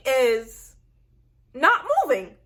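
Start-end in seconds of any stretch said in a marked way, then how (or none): none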